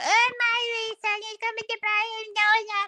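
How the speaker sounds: high, soft mocking imitation of her; high mimicking whine; mock whiny mother. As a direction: squeak it out like a fussing mother. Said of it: "that you were talking about".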